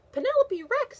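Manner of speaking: lightly voiced strict teacher; teacher scolding. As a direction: call the pupil to order. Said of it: "said Miss Noodleman".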